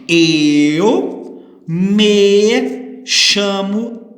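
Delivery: drawn-out words with long pauses; deliberate, word-by-word teacher delivery; slow example sentences. Shown as a demonstration. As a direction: model each sentence very slowly for a class. Reading out model example sentences.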